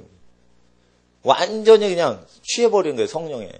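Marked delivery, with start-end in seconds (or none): none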